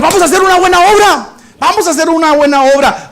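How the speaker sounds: high, loud do-gooder youths; eager youths. Acted. Young volunteers rallying each other, high and eager. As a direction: cheer it out like eager kids. Youths voiced in sermon story.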